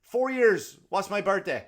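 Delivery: high and loud, half shouting; loud excited challenge. Retold or imitated. Teller shouting his birthday challenge at the savant, high and loud. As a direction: demand the next answer, thrilled. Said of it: "I go".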